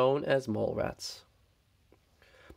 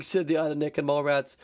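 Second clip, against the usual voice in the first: ordinary delivery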